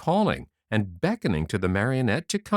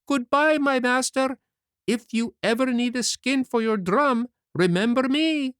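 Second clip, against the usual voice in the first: calling out far away. A narrator's reading. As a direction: shout a cheeky goodbye from the water. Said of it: "he cried out, laughing".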